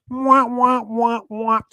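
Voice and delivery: high mock brass wah wah; sad trombone wah-wah. After a rude double meaning, sad trombone.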